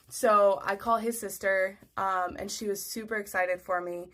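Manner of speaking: ordinary talk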